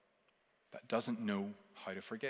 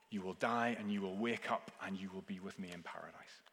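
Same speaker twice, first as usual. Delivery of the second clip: soft whispered promise; Jesus whispering to the dying criminal; 4 semitones lower, 5 dB quieter than usual. Acted. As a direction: whisper it like a secret comfort.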